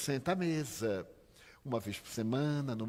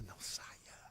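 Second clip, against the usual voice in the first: a faint, breathless dying whisper; weak whisper; 4 semitones lower, 14 dB quieter than usual. Retold or imitated. Dying father whispering to his son not to leave. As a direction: barely breathe it out, no voice.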